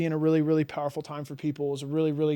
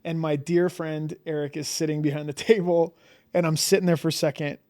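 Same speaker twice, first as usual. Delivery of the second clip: ordinary delivery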